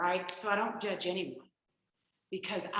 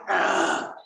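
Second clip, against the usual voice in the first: groggy groan from the husband; 8 dB louder than usual. Retold or imitated. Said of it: "he was like".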